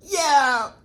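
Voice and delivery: shrieking laugh. Real, not acted. Vlogger laughing at a contestant's tantrum, shrieking. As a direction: let out a screech of laughter.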